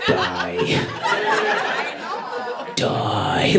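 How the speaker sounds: muttered hate. Retold or imitated. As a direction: hiss them under your breath at the screen. Inner voice scrolling Facebook.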